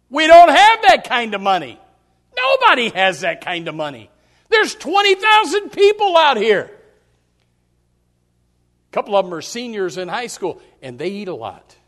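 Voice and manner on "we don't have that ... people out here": exasperated Tim. Retold or imitated. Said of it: "Tim does his job and says".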